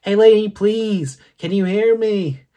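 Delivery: put-on high, loud pleading child; little boy voice. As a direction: call out like a small boy trapped in a purse. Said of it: "It's the sound of a little boy saying".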